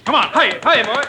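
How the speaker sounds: loud high urging to a horse; calling out. Acted.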